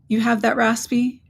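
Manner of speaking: usual speaking voice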